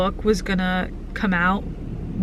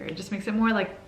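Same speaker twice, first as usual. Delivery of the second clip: usual speaking voice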